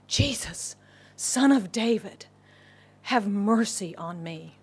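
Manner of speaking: normal talking voice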